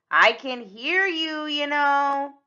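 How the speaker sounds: loud, high, slow and pointed; annoyed call-out. Acted. Red overhearing the villain's plan, annoyed. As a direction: draw it out loudly, unimpressed.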